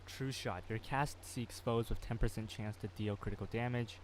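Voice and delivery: ordinary talk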